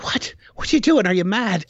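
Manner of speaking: the mother squawking that her son's gone mad; shocked mother. Retold or imitated. Mother hearing her son will show Americans the castle, shocked and high. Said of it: "She's like".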